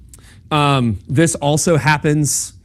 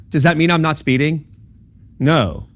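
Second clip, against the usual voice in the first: ordinary talk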